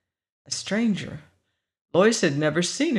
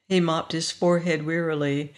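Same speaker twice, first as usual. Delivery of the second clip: usual speaking voice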